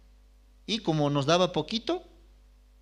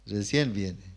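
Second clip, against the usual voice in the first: lower, softer, chuckling echo; amused echo of late grandmother, softer with a chuckle; laughing repeat; 7 semitones lower, 3 dB quieter than usual. Shown as a demonstration. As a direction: laugh at how late she came.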